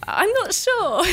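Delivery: unsure reply. Retold or imitated. Acts out her baffled reply.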